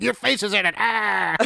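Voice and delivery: high, singsong mock bully; schoolyard bully taunt. Voices the bully rubbing a face in slime, taunting high. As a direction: tease like a playground bully. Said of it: "He's like".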